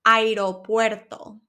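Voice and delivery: Aeropuerto, each syllable separate; slow syllables. Shown as a demonstration. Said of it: "say it slowly".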